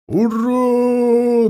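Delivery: high, sustained hurrah; long charging cry carried from the hillsides; battle cry. A narrator's reading. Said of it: "донёсся клич".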